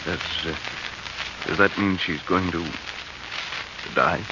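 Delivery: can barely get the question out; stunned fear. Acted. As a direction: horror sinking in, voice dropping away. Husband hears wife is dying.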